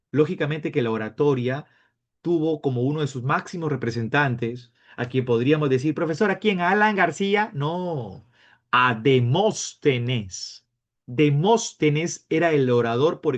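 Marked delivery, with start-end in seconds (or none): 5.9-7.5 higher-pitched mock pupil; imitated student asking, voice raised in pitch; student's guess. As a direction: pipe up with a naive guess. Teacher voices a student's wrong guess.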